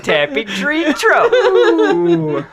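very slow and high through laughter; laughing. Pretend. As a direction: drag it out while laughing. Laughing goofy voice.

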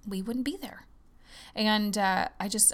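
ordinary talk, nothing marked

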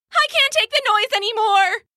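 high, loud and anguished; desperate cry. Acted. Guilty child crying out to her moms. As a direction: plead at the end of your rope.

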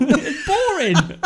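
slow, high, laughing put-on voice. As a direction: drag it out high while laughing. Laughing mock Loki line.

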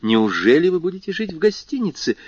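high-pitched, incredulous question; astonished exclamation. A narrator's reading. Guest objects to the count living in a hotel, astonished. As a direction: exclaim it in rising disbelief. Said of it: "воскликнул Морсер".

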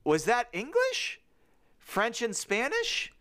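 slow, high baffled question; puzzled wonder. Acted. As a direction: ask it in drawn-out mock amazement. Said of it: "they're just like, Whoa".

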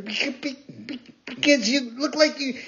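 high, stammered broken start; stuttering. Acted. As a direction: stumble over the word in shock. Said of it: "stammering and stuttering".

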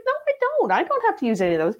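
high, fast, defiant protest; insistent retort. Retold or imitated. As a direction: push back on the boss, high and quick. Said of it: "I said".

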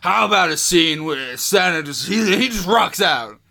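loud, hammy Kurt Russell pitch; Kurt Russell impression. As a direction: boom out the pitch like a star. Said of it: "he's like".

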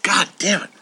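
ordinary delivery

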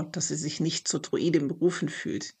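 ordinary delivery

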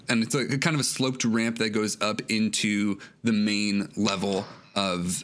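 normal talking voice

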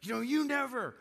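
hammed-up accusation in a fight; mock spouse accusing. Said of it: "they're like".

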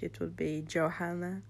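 ordinary talk

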